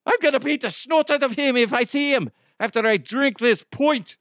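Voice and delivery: high-pitched, hammed-up Irish threat; mock-indignant Irishman with a thick brogue; Irish accent. Offended Irish listener threatens the host, Irish accent. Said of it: "Irish people listening to this going".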